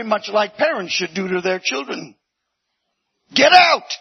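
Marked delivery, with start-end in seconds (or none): 3.3-4.0 high, loud command to leave; stern shout. Acted. Parents ordering the grown child out, loud and stern. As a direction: bark it like a fed-up parent.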